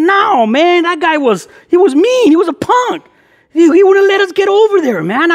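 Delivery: high-pitched, hammed-up outrage; worked-up mock indignation; faked gangster anger. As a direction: fake being mad to prank a buddy. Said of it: "put in my old gangster face, all mad dogging".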